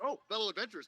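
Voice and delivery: light up at a sales chance; perks up. Acted. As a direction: shopkeeper hears of adventurers and perks up. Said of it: "And he perks up".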